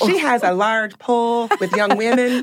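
playful Southern belle imitation; Southern accent. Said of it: "I can do a Southern accent".

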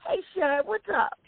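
high mock greeting. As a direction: put on a sugary high voice to greet them. Retold greeting in a put-on voice.

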